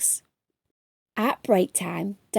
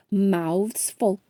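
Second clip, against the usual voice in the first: usual speaking voice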